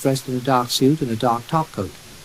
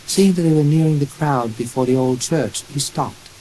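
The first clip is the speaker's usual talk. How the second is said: ordinary delivery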